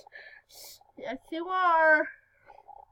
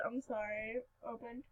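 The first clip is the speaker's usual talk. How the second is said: much softer apology after yelling; sheepish quiet; 6 semitones lower, 15 dB quieter than usual. Real, not acted. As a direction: drop to a near-hush, embarrassed. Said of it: "Oh, I yelled".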